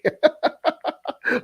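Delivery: high burst of laughter. Real, not acted. Comedian laughing at his own Waffle House story, high.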